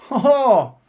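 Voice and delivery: a loud, high burst of "ho, ho"; hearty laugh. Real, not acted. Host laughing loudly as Newcastle draw Bradford.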